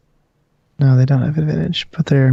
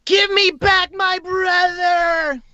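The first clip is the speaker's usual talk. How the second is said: high, drawn-out wail of a ghost; yelling ghost; much slower than usual. Acted. Ghost child to its brother's destroyer, high drawn-out yelling. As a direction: yell it in a very high, slow voice. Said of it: "you can hear yelling at you".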